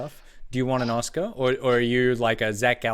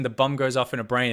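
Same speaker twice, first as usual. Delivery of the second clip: ordinary talk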